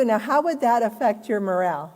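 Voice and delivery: normal talking voice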